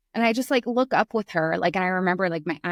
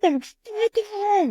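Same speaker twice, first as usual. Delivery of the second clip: high, drawn-out mock scream; retold yell; 12 semitones higher, slower than usual. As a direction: reenact a frustrated yell, long and high but held back in volume. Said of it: "I yelled".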